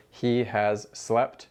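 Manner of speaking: slow, deliberate It has been; slow teacher demo; slow example. Shown as a demonstration. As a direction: spell It has been out word by word. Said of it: "in the same way that we say in English".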